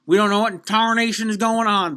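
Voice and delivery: put-on southern accent. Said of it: "I should drop the southern accent".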